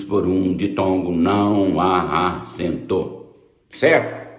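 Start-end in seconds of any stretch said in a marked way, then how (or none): 1.1-3.3 não há acento slowly, syllable by syllable; emphatic slow delivery. Shown as a demonstration. Teacher states the rule to class, não há acento slowly spelt out. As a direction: space out não há a-cen-to.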